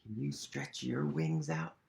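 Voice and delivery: ordinary delivery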